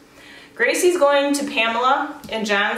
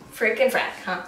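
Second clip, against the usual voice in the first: ordinary talk, nothing marked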